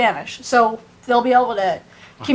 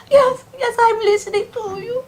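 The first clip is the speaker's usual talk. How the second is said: high, crying little voice; imitated tearful yes; 10 semitones higher than usual. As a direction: mimic the sobbing girl, pitched up. Tiny sobbing girl answering.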